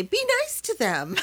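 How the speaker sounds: high-pitched mock scolding; admonishing. Colleague telling off a booing audience for the young emcees, admonishing. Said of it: "admonish the audience and say".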